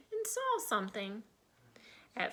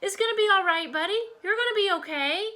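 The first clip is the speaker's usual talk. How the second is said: reassuring; 10 semitones higher, 3 dB louder, faster than usual. Acted. Reassuring trapped cat.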